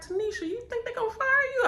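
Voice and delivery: quick, high mock concern; coworker's voice. Coworker asks Tanisha if she'll be fired, in a high fast imitation.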